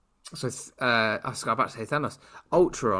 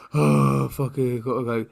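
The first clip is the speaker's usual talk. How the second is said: big reluctant sigh into grudging words; massive sigh; 6 dB louder than usual. Retold or imitated. Podcaster mimicking Clint reluctantly going for the kid, sighing. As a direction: imitate a hero heaving himself into action. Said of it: "He did it with a massive sigh".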